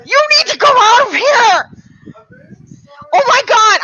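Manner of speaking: real, very loud and high startled yell; startled shout. Real, not acted. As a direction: shout in genuine fright. Startled by someone behind him.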